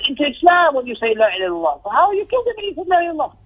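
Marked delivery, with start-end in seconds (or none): 1.8-3.3 high, fast reproach; rebuking question. Retold or imitated. Preacher retelling the Prophet rebuking Usama, high and fast. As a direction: press him with a sharp question.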